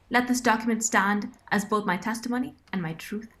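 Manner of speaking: normal talking voice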